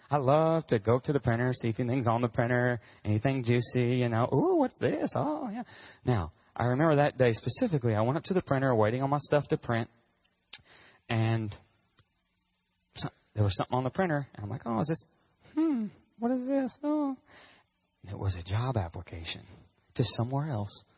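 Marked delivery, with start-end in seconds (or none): 4.3-5.6 high, cooing snoop; hammed-up curiosity in a high little voice; nosy delight. Office worker snooping at the printer, nosy delight. 14.7-17.2 nosy curiosity. Acted. Worker finding something on the printer, nosy curiosity. Acts discovering paper on printer.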